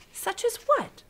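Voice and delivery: put-on high, eager little girl voice; curious girl questioning the giant. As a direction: pitch it up like a curious child. Sophie voice in read-aloud story.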